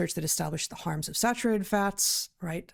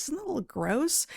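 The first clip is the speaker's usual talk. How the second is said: much higher, sing-song mock question; mock disgust; 10 semitones higher than usual. Host parodying the article's appeal, mock disgusted and higher. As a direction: tease the audience with fake disgust.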